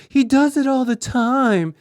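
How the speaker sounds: mock whiny girlfriend. Girlfriend answering the mom, in a whiny high voice. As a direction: whine it like a sulky girlfriend.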